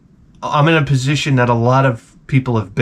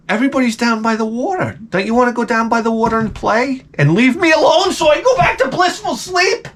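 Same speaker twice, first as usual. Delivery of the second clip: high singsong coaxing, climbing into a squeal; mock-sweet talk to a child, much higher; fake-sweet brush-off; 10 semitones higher than usual.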